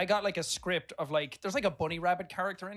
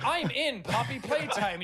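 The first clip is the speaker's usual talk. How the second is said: excited; 9 semitones higher, 6 dB louder, slower than usual. Retold or imitated. Acting out excited reaction.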